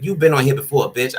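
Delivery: ordinary talk, nothing marked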